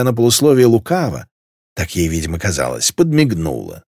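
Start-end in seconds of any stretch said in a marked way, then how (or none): none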